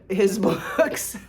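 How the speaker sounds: slow, laughing through the words; giddy laugh on books. Real, not acted. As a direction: giggle while finishing the thought. Laughs mid-phrase.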